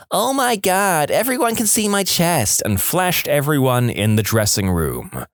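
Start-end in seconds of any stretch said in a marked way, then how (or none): 0.1-2.6 high, slow mock sobbing voice; mocking fake crying. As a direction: ham up a whiny fake cry. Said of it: "fake crying in my voice".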